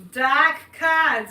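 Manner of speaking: Boston accent, r dropped in "cards" as "cahds", loud; dropped-R Boston "Dark cards". Shown as a demonstration. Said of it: "as we say in Boston".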